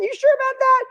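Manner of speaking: very high, doubtful squeak; skeptical doubt. Retold or imitated. Acts out own doubtful reply.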